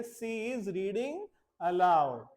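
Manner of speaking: "She is reading aloud" said slowly, stress on "reading"; slow model sentence. Shown as a demonstration. Teacher modelling English for the class, slow. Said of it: "ये जोर से पढ़ रही है".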